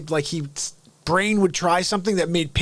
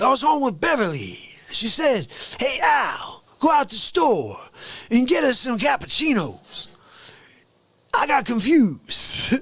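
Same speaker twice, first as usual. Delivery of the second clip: comic character voice; 8 semitones higher than usual. Acted. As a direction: pitch it up, stretch words into falling swoops.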